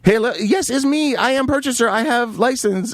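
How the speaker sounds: high, mock foreign buyer voice; broken-English buyer. Voicing buyer's broken-English messages.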